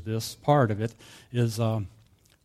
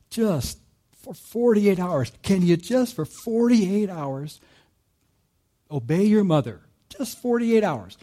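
high, slow pleading, pressing each forty-eight; a father begging his son, much higher and slow; 8 semitones higher, 6 dB louder, slower than usual. Retold or imitated. Father pleading with his son Gabe, high and slow.

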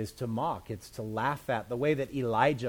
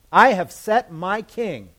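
slow, loud and high; booming decree; 6 semitones higher, 11 dB louder, slower than usual. Acted. Preacher voicing the Father's decree, booming. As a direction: proclaim it like a royal decree.

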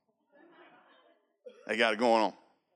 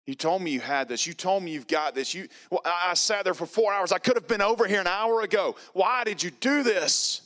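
angry at God; 7 semitones higher than usual. Retold or imitated. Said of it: "just mad at God".

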